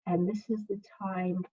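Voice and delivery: put-on reading to children. Second grade teacher reading a picture book to her class, soothing.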